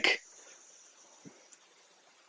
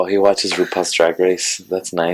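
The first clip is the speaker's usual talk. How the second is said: unimpressed retort; 5 semitones lower, 4 dB quieter than usual. Retold or imitated. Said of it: "And I was like".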